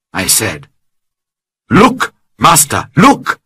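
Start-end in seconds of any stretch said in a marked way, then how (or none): 1.7-3.4 excited cry. Acted. Friday calling to his master, excited cry. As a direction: call out, thrilled at spotting home. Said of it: "Friday cried".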